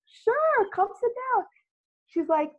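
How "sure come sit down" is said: pitched way up, eager and friendly; high eager welcome. Retold or imitated. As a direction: chirp it like a keen host. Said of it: "I'm like".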